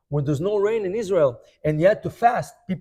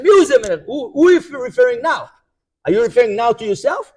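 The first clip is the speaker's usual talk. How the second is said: indignant challenge; 5 semitones higher, 8 dB louder, faster than usual. Retold or imitated. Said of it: "And they said again".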